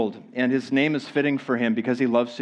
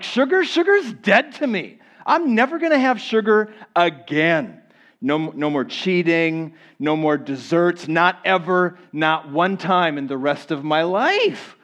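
determined; 2 semitones higher than usual. Acted. Preacher playing a dieter before the diet starts, mock determination. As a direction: swear it off with mock-heroic resolve. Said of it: "you're full of courage and determination".

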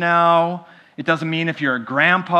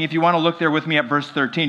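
normal talking voice

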